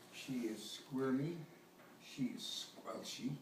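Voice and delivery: ordinary delivery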